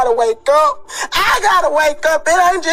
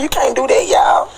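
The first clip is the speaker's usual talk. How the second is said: ordinary talk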